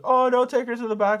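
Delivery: high-pitched imitation of disappointed customers; put-on high voice of protest; mock pleading protest. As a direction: whine it up high, mimicking them. Imitating customers protesting about the dog.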